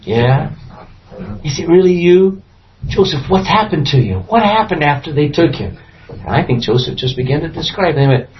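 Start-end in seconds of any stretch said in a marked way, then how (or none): none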